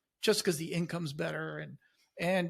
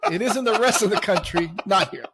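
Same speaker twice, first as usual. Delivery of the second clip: loud laughing reply; laughing out loud, half shouting; 4 semitones higher, 8 dB louder than usual. Real, not acted. Co-host laughing at the joke.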